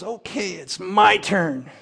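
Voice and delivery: squeaky, weak voice. Acted. Battered lawyer struggling to his feet, squeaky and weak. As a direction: squeak it out weakly, drawn out. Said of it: "in a squeaky, weak voice".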